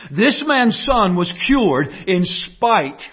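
slow, weighty words; slow down and press each word; slow emphatic. Real, not acted. Preacher slowing for emphasis.

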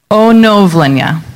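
onovlenia with rising end, o-nov-le-nia; Ukrainian word. Shown as a demonstration. Teaching the church Jeremy's favorite word, careful and clear. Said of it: "Jeremy's favorite word".

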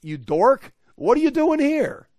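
loud, high-pitched exasperated scolding; exasperated paraphrase. Acted. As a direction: shoot up high in disbelief at the dork. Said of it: "If I could paraphrase".

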